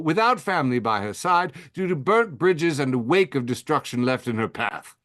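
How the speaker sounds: put-on a mild stab at a British accent, held throughout. Reading an evil obituary to the audience, in a mild British accent. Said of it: "I'm gonna do a British accent for it".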